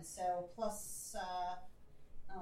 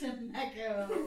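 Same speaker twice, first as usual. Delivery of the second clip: Simpsons quote; 7 dB louder, much slower than usual. Retold or imitated. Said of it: "From the Simpsons".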